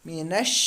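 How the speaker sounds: मीनश with the शीन sound pressed hard and firm; मीनश recited with a strong, firm शीन; firm shin. Shown as a demonstration. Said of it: "शीन में थोड़ी सी सख्ती करनी है".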